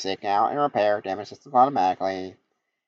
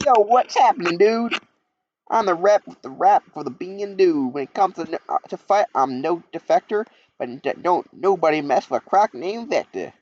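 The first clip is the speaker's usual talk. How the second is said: much higher, louder hip rapper croc; Vector the crocodile; 11 semitones higher, 4 dB louder than usual. Acted. The crocodile introduces himself with a rap, much higher swaggering voice. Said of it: "I'm not talking like that".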